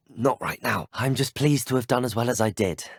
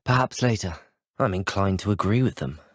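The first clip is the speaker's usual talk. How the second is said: normal talking voice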